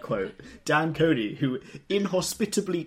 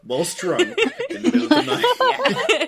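high, laughing in disbelief; 4 semitones higher, 6 dB louder, slower than usual. Real, not acted. Teasing the friend about her horse game, laughing. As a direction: laugh out the question.

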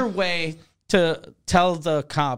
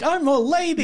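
mock lady voice; 11 semitones higher than usual. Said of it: "and he's like".

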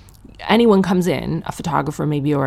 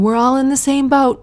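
high, loud singsong ad voice; mocking campaign slogan; 9 semitones higher, 5 dB louder than usual. Comic mocking a covid campaign's cheesy slogan. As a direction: parody a cheesy public service ad. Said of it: "That covid campaign".

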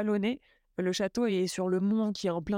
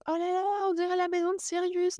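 gushing fan reaction; 9 semitones higher than usual. Retold or imitated. Traveller retelling her Harry Potter fan reaction, high-pitched gushing. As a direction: squeal it like an awestruck fan. Acting out own excited past reaction.

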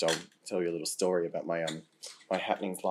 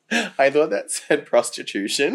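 comic disbelief; 7 semitones higher, 11 dB louder, slower than usual. Real, not acted. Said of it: "I can't read my own typing".

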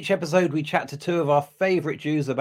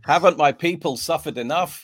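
mock martyrdom; 5 semitones higher, 5 dB louder than usual. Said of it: "that's how you win any argument".

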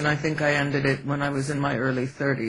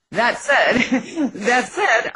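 laughing; 7 semitones higher, much slower than usual. Real, not acted. Laughs while restarting the sentence.

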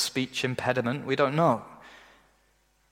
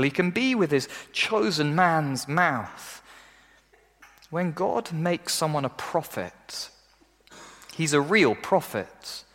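usual speaking voice

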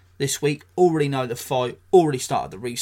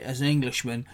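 ordinary talk

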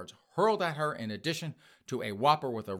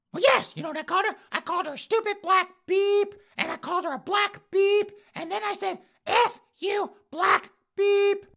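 put-on high, loud huffy old lady; old woman voice; 5 dB louder than usual. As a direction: squawk it out like an outraged old lady.

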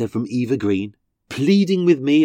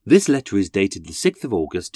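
ordinary delivery

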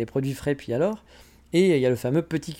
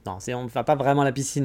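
normal talking voice

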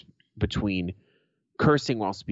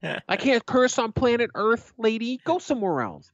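indignant comeback; 12 semitones higher than usual. Retold or imitated. Nineteen-year-old telling off a woman on the street, indignant. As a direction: protest in a raised voice, then dismiss her. Retold quarrel with scolding woman.

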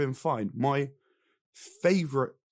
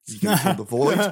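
mock excited note; 4 semitones higher, 9 dB louder, faster than usual. Reading own notes, laughing.

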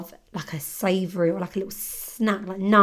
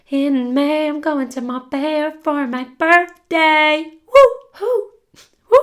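loud, high and drawn-out excitement; excited; 7 semitones higher, 7 dB louder, slower than usual. Real, not acted. Telling listeners about her birthday trip, excited whoop. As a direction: cheer it like a birthday countdown.

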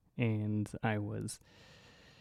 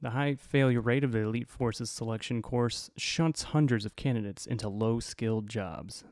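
normal talking voice

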